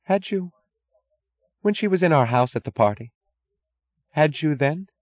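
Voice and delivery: high-pitched, halting question; interrogating wife. A narrator's reading. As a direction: press him for the date. Wife interrogating husband about affair.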